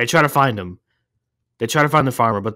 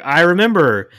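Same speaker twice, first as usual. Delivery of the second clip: sudden memory; 7 semitones higher, 6 dB louder than usual. Retold or imitated. Farmer voiced in retold movie.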